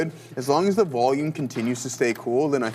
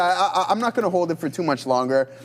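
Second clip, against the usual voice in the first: ordinary talk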